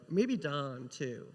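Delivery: normal talking voice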